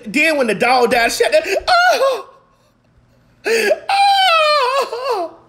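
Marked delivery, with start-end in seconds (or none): 1.7-5.4 very high drawn-out mock sob; hammed-up crying howl; high-pitched wail. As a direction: wail "oh" as if crying over the dog. Reacting to the dog's death scene.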